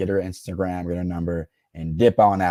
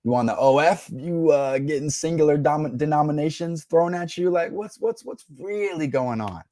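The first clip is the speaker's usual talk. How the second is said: probing mock questions, pitch climbing higher; mock interrogation; 6 semitones higher than usual. Streamer imitates grilling a girl, mock suspicious questions climbing high. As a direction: tease her with questions rising higher. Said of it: "I'm like".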